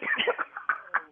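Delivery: breathy laugh after being pranked; real helpless laughing; relieved laughter. Real, not acted.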